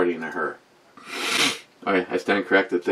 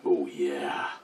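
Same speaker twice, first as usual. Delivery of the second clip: whispered; 7 semitones lower, 12 dB quieter than usual. Real, not acted. Reply to weather report.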